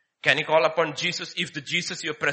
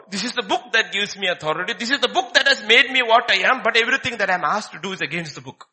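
climbing louder with each line; preaching build; 5 semitones higher, 7 dB louder than usual. Acted. Preacher building fervour about the book's authority.

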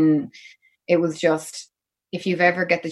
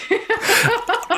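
high embarrassed laugh through tears; teary laugh. Real, not acted. Touring dancer laughing off her tears with the host, teary laugh.

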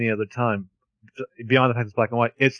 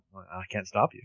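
soft, low mock concession; resigned reply; 5 semitones lower, 9 dB quieter than usual. Imagined director giving in to the actor, soft resigned mumble. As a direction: give in quietly, play-acting. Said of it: "And he's like".

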